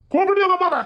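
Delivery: loud, high angry yell; furious husband shouting during a quarrel. Acted. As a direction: bellow at her to fetch her mother. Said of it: "ஏன் கத்துறீங்க?".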